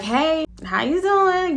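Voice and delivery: high, slow, sing-song hello; cheery greeting. Retold or imitated. Walking into the gas station, slow high sing-song hello. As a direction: greet her like nothing happened. Greeting someone after long absence.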